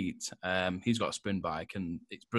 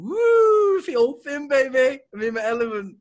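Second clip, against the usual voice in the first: high, loud mock-triumphant whoop; gleeful whooping; 14 dB louder than usual. Joking about feeling thin on the exercise bike, gleeful whooping. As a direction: squeal it out like a thrilled show-off.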